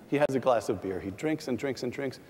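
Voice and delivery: ordinary talk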